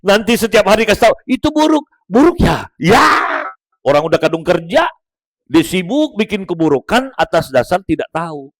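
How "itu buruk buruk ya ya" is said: correcting. Retold or imitated. Someone correcting you daily.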